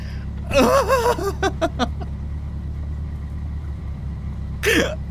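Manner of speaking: high-pitched laughing fit; helpless laughter. Real, not acted. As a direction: crack up helplessly as the game breaks. Game breaks, player cracks up.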